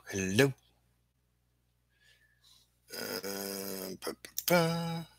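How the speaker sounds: ordinary talk, nothing marked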